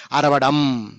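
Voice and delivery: ordinary talk, nothing marked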